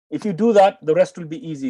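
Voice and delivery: ordinary talk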